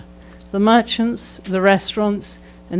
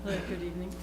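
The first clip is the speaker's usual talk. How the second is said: far from the mic, very faint; barely audible; 16 dB quieter, much faster than usual. Real, not acted. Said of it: "Could you speak up".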